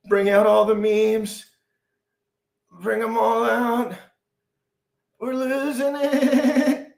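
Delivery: high-pitched manic shout; manic excitement. Real, not acted. Calling for memes as Bitcoin pumps, high manic shout. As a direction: lose it with excitement.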